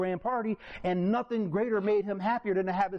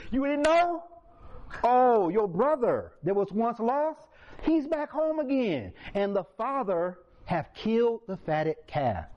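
high, slow, relishing servant voice; gossiping servant; 6 semitones higher, slower than usual. Retold or imitated. Servant telling older brother the news, relishing. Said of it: "The servant was like".

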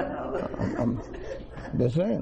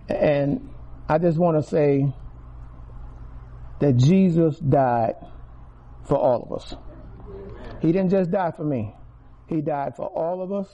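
preaching; 4 dB louder than usual. Acted. Telling the church Jesus died for all, loud preaching. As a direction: lean into it like a pulpit climax.

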